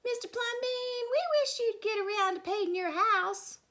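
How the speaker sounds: high, loud pleading neighbour voice; nagging neighbour. A narrator's reading. A neighbour nags Mister Plumbean to repaint his house. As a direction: squeal it up high like a fussy neighbour. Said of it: "someone said".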